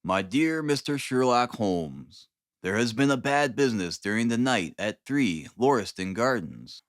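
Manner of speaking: ordinary delivery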